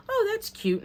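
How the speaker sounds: high-pitched mock delight. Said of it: "I'm like".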